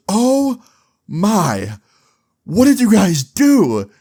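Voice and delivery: high-pitched, drawn-out horrified gasp of words; shocked mom. Retold or imitated. Mom seeing what the boys burned, loudly aghast. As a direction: act out an aghast mother, stretching each word. Mom sees the fire damage.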